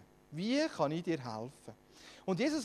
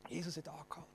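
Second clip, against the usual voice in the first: hushed whisper; 3 semitones lower, 15 dB quieter, much faster than usual. Shown as a demonstration. Preacher whispers to the congregation that Jesus stopped, awed whisper.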